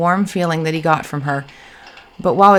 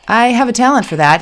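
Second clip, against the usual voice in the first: higher, louder, lightly amused; light laugh in voice; 6 semitones higher, 6 dB louder than usual. A narrator's reading. Man jokes about his clumsiness, light laugh in voice. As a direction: joke at your own expense, a touch of laughter. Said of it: "a light laugh in his voice".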